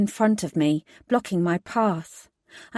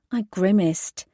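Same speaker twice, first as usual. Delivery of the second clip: ordinary delivery, nothing marked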